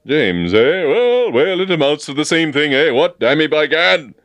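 loud, high blustering colonel; booming military joviality; bluff soldierly manner. A narrator's reading. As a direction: bluster heartily like an old soldier. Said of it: "return of his bluff, soldierly manner".

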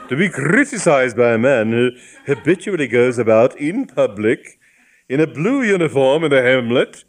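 lofty, pompous bishop voice; indignant bishop. Acted. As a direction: lecture with wounded dignity.

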